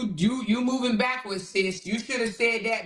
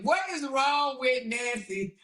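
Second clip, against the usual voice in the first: ordinary delivery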